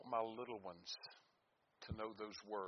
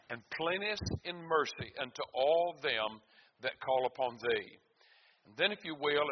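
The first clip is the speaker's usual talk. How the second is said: loud, ringing pulpit voice; fiery preacher reading the Psalms; preaching at full force; 2 semitones higher, 9 dB louder than usual. Acted. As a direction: preach it out to the congregation. Preaches and reads Scripture aloud.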